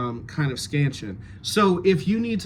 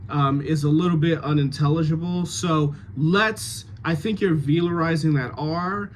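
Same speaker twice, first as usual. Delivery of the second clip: ordinary talk, nothing marked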